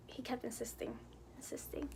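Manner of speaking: hushed, breathy whisper of an aside. Real, not acted. Telling listeners about her friend's insisting, whispered. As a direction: drop to a whisper as if sharing a secret.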